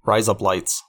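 razor blades in mock Aussie; put-on Australian vowels; Australian accent. Shown as a demonstration. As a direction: demonstrate the Australian-accent trick phrase. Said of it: "If you wanna say razor blades".

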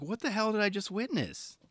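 high-pitched, incredulous retold reaction; amazed, pitch jumping up; stunned disbelief. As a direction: act out being floored by what you saw. Retells his stunned reaction to clip.

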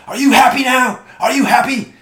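high, loud accusing shriek; mock screaming. Dog owner out of her car confronting him, mock screaming. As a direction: imitate her yelling it in outrage.

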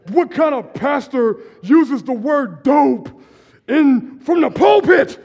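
outraged churchgoer. Retold or imitated. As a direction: ham up the scandalized church lady. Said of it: "people are like".

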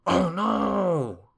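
high, loud, drawn-out dismay; horrified gasp. A narrator's reading. Mister Mean finding a carrot nose, horrified. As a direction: cry out in alarm. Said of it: "he gasped".

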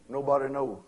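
put-on the old trail hand, unbothered and low; laconic old cowboy. Old cowboy telling the preacher nobody knows, low and quiet.

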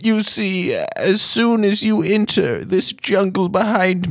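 high, slow, ominous gnome wizard warning; old gnome wizard. Acted. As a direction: warn of the curse like a creaky old sage.

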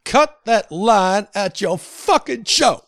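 slow, emphatic "fucking"; stress on fucking. Shown as a demonstration. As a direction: lean hard on "fucking". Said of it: "How fun is it to say the fucking part? The emphasis".

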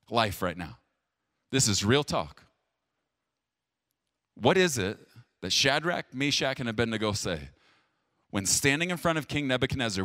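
ordinary talk

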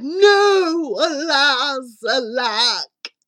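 melodramatic lament, loud and high then falling; anguished cry. Acted. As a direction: wail it like a lovelorn prince. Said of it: "The prince cried".

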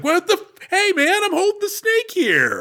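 high, yelping startled voice; surprised Van Cleef. Pretend. Van Cleef startled when the snake gets shot, high alarmed protest. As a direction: squeal it like a man caught off guard.

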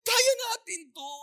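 high, pleading through tears; wife crying to keep her husband; tearful plea. Acted. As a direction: hold on, don't leave me. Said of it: "Iyak siya ng iyak".

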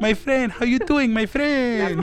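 high-pitched comic Puerto Rican doctor; amused Puerto Rican accent impression; thick Puerto Rican accent. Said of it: "thick Puerto Rican accent".

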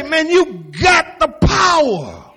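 high, slow frustrated shout; Jesus shouting in frustration; exasperated shout. Retold or imitated. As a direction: rebuke the lazy disciples. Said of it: "Jesus say".

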